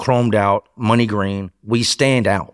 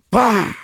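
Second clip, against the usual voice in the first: punch sound; 12 semitones higher than usual. Retold or imitated.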